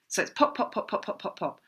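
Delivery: breathy little pops in a row; light voiceless pop pop pop; popping sounds. Shown as a demonstration. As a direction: pop each bubble with your lips. Mimics bubbles bursting.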